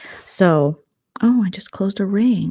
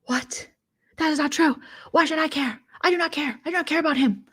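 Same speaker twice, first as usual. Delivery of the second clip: high, indignant mock squeal; mocking Momo; 10 semitones higher than usual. Imitating Momo denying she cares in a high squeal. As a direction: squeal out the denial. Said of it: "And Momo is like".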